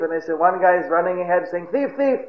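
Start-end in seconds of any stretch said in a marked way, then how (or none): 1.7-2.2 imitated chase cry. One man running ahead, light high mock cry. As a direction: mimic a distant yell in a high voice. Runaway thief leads the outcry.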